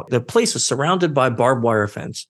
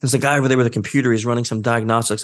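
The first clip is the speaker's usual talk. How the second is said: ordinary delivery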